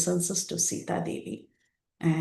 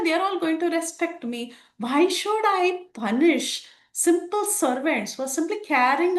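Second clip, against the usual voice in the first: Sita in a higher, forgiving voice; Sita gentle; 6 semitones higher than usual. Acted. Sita gently telling Hanuman not to punish the servants, in a higher voice. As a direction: play Sita calmly refusing revenge, voice lifted.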